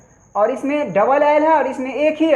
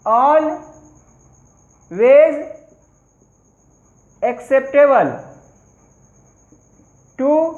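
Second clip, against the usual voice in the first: word by word with long pauses, for students to write; slow dictation; much slower than usual. Shown as a demonstration. Teacher dictating an example sentence to the class, slow and word by word. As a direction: dictate each word slowly so the class can copy.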